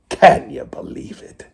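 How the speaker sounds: whispered moan. Acted. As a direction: breathe it out in dismay. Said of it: "Pete moaned to his parrot".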